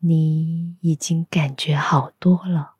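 normal talking voice

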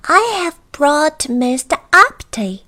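high, loud little goblin. Acted. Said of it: "said the Goblin".

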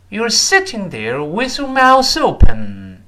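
slow, high, loud line for repeating; slow model reading. Shown as a demonstration. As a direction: draw it out clearly for learners. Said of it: "慢慢来".

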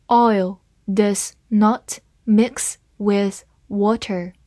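slow, word by word; slow reading. Shown as a demonstration. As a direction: read it slowly and clearly for learners. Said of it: "好，慢慢来读。".

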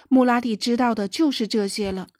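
plain reading voice

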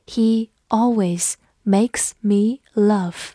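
slow, careful model reading; teacher reading slowly for the class. Shown as a demonstration. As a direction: stretch each word out slowly. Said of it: "我们慢读一遍".

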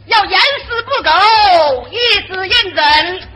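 hammed-up official shouting; mock stern shout. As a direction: shout it like a pompous troupe leader. Mimicking troupe leader's lecture.

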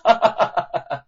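loud falling chuckle; short laugh. Acted. Young man mocking the guards, short laugh. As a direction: finish with a laugh. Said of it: "禁不住的大笑着".

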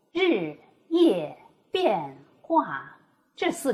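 日夜变化 slowed down, each syllable falling sharply; fourth tone demo. Shown as a demonstration. Teacher slowly modeling the falling fourth tone. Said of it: "这四个音节的声调".

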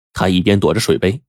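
usual speaking voice

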